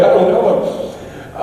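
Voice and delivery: slow, high and loud denial; mock disowning. Speaker inside the airport, slow laughing denial. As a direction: step away from him, drawn out.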